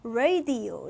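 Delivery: 라디오 as English "RAY-dee-oh"; English "radio" with a gliding first vowel; American pronunciation. Shown as a demonstration. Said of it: "걔네는 어떻게 읽겠어".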